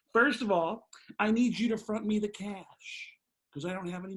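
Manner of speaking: loud, high ranting comedian voice; Sam Kinison. Retold or imitated. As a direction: rant it like a wild stand-up legend. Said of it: "that's a good impression".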